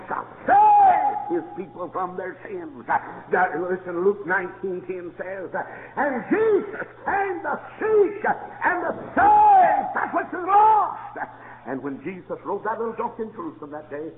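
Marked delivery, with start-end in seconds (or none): 6.0-11.2 high, slow proclaiming; preached scripture. Acted. Preacher proclaims Luke nineteen ten, high and slow. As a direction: proclaim each word slowly.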